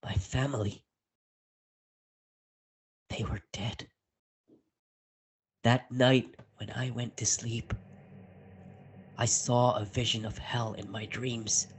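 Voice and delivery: ordinary delivery, nothing marked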